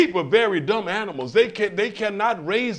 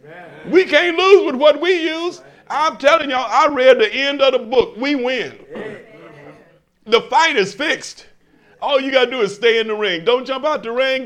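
higher and louder, rousing the congregation; full-force preaching; 6 semitones higher, 5 dB louder than usual. Acted. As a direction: preach it out to the crowd, building.

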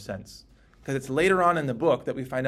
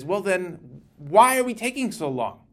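impatient plea; 5 semitones higher, 5 dB louder than usual. Acted. Voicing the martyrs' impatient cry.

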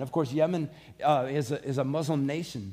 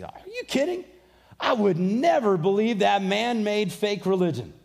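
scoffing retort; 5 semitones higher, 4 dB louder than usual. Retold or imitated.

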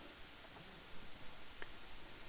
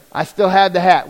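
usual speaking voice

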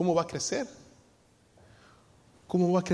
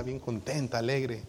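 ordinary talk, nothing marked

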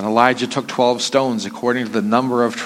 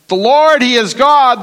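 loud cry; 10 semitones higher, 5 dB louder than usual. Acted. People fall on their faces.

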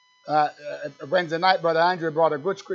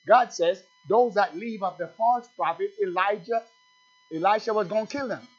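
raised, higher preaching voice, driving hard; fiery preaching; 4 semitones higher, 6 dB louder than usual. Acted. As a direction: preach it out to the congregation at full force. Preacher at full pitch.

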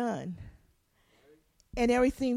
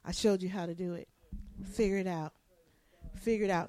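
softer, low, hands-off firmness; unbothered parent; 6 semitones lower, 7 dB quieter, faster than usual. Acted. Parent stands back from struggling child, unbothered firmness. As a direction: say it evenly and step back. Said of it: "and we say".

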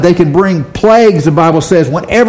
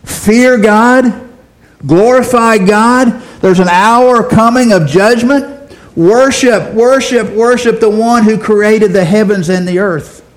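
high, ringing call from the sky; angel's loud proclamation; 5 semitones higher than usual. Acted. Angel calling down over Arapahoe, high proclaiming cry. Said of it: "proclaiming with a loud voice".

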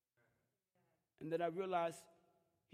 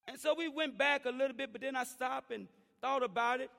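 loud, high preaching voice, raised at the church; preaching at full force; 9 semitones higher, 10 dB louder than usual. Acted. Pastor preaching loud to the church about parents. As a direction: preach it out to the congregation.

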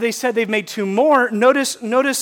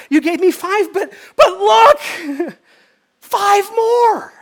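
high, loud, laughing giddy show-off; bursting with delight, slowed on "look"; pumped servant; 12 semitones higher, 5 dB louder, slower than usual. Acted. Servant showing the master his gains, laughing with joy. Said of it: "He's pumped".

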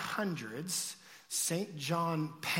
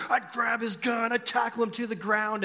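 loud, raised mock tough-guy boast; amped-up bravado; 6 semitones higher, 5 dB louder than usual. Camp kids boasting to each other, mock bravado. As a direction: imitate kids talking big, loudly.

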